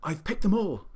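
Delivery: high, breath-only whisper; excited whisper. A narrator's reading. Billy to the pelican, job done, whispering. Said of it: "I whispered to the pelican".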